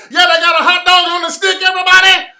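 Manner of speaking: high, very loud shouted hype-man voice; screaming crispy character voice; crispy voice yell. Retold or imitated. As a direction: bellow it like a hyped-up character who won't quit. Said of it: "wouldn't stop doing the crispy voice".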